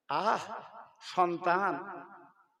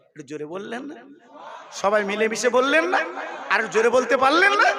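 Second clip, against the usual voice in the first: preacher eggs the audience to chant louder; rousing the crowd; 2 semitones higher, 8 dB louder than usual. Real, not acted. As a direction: call on them to say it louder.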